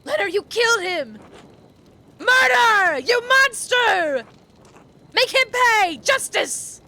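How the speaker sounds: mob outrage. Acted. Mob reacts to a killing.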